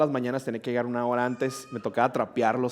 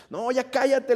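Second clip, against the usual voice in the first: mock irritated refusal; 10 semitones higher, 4 dB louder, slower than usual. Narrator telling girl to stop.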